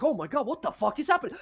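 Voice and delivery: high, fast, startled outburst; alarmed guard. Acted. Guards hear the demon yelling, startled. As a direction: blurt it out in shock.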